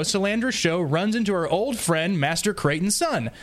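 ordinary talk

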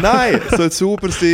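laughing protest. Real, not acted.

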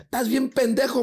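high mock-angry insult; scolding sister. Sister to foolish brother, scolding.

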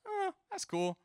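high, offhand brush-off; mock a dad's nonchalant approval; underwhelmed dad. Dad answers son's four point oh, underwhelmed.

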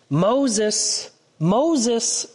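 a calling voice from the bush, stretched out; loud calling voice. Acted. God calls to Moses from the bush, a loud call. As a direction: call his name out from the fire. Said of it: "said a loud voice".

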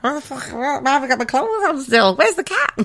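high, bewildered morning-after panic while laughing; mock confused panic. Waking up still dressed, high laughing panic. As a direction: play the hungover wake-up, half laughing. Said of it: "You're like".

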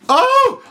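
sudden high yelp of fright; high-pitched startled cry; retold scream. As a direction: shriek it out like a startled woman. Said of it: "I hear a scream".

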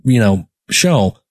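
ordinary delivery

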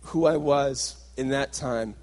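ordinary talk, nothing marked

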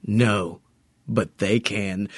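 a hint of a menacing boss, sinking low and soft; villain voice. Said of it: "and Pizza the Hut's like".

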